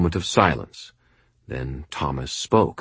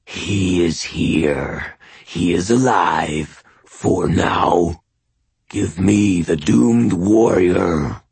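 slow, purring inhuman creature, swelling louder; purring monster voice; 4 dB louder, much slower than usual. A narrator's reading. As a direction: savour each word like a predator. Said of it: "yowl, purring voice of the skinwalker".

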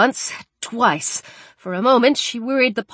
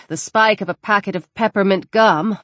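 usual speaking voice

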